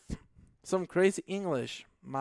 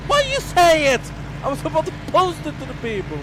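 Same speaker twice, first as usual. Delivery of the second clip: high, fast protest; playful indignation; 12 semitones higher, 8 dB louder than usual. Real, not acted. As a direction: complain that the surprise got spoiled. Mock complaint at spoiled surprise.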